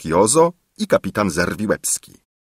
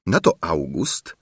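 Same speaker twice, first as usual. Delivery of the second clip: plain reading voice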